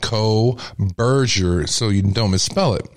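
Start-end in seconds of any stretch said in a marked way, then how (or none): 0.0-1.7 Kohlberger drawn out very slowly; slow spelled-out name. Shown as a demonstration. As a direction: stretch each syllable of Kohlberger. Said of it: "You say it in your mind".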